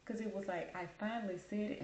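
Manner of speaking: ordinary delivery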